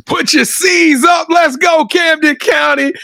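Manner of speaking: high, drawn-out hype call; pumped-up shout-out, pitched high; rallying shout. Acted. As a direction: call out to rally the home crowd. Rallying call opening the interview.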